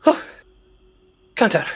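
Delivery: usual speaking voice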